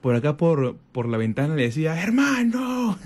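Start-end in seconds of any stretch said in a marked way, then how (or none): 2.0-3.0 high calling shout for a brother downstairs; shouting from window. Retold or imitated. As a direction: yell it out the window to the street. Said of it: "le gritaba".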